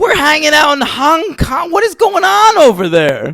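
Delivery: very high-pitched indignant outburst; exasperated, high-voiced complaint; incredulous protest. Real, not acted. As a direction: squawk it in disbelief at the film. Heated complaint about Hong Kong scenes.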